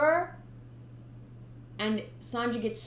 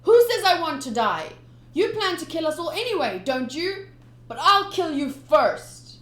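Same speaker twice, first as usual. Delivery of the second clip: put-on loud, high boy threat; a kid snarling back at a pirate; young Sanji defiant; 7 semitones higher, 9 dB louder than usual. Kid threatening the captain, loud and higher.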